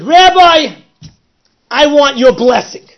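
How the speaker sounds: high, loud pleading cry; high, loud voice begging for the blessing; loud plea. Retold or imitated. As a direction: call out to the rabbi, begging. Mafia guy pleads with rabbi.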